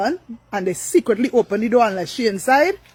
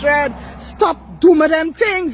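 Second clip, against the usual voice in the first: tearful raised voice, dragged out slowly; crying plea; 5 semitones higher, 3 dB louder, much slower than usual. Retold or imitated. To best friend Dana misusing her name, crying plea. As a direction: cry out as you beg her to stop.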